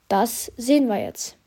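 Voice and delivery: usual speaking voice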